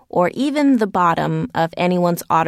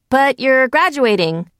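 raised, higher-pitched protest; mild yell; 6 semitones higher, 3 dB louder than usual. A narrator's reading. Millicent to Tommy as he disappears into the crowd, mild yell. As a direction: call it out in mild dismay, pitched up. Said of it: "I yelled as I watched him".

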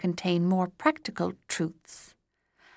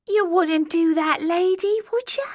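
high, whiny goblin plea; whining goblin; 11 semitones higher, 5 dB louder than usual. A narrator's reading. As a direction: beg like a scared goblin. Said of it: "he whined".

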